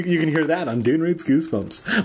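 ordinary delivery, nothing marked

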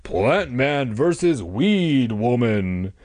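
very slow, drawn-out superhero announcer; hammed-up Plant Man voice; mock-heroic declaration. A narrator's reading. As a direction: stretch every word like a monster-movie title. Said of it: "Casey declared".

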